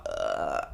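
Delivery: very low, drawn-out guttural "ugh"; imitated guttural sound. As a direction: drop deep into a droning throat noise. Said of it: "that making that sound".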